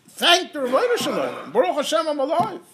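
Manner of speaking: high, loud burst of joyful gratitude; bright loud glad thanks with a laugh. Pretend. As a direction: burst out with real joy at being alive. Acting out heartfelt morning gratitude.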